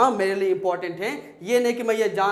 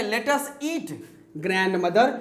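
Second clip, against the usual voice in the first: "Let us eat", pause, then "grandmother" rising as a call; much slower than usual. Shown as a demonstration. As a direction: break after eat, call out grandmother with a lift. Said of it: "grandmother को आप आवाज़ दे रहे हो". (grandmother को पुकारते हुए)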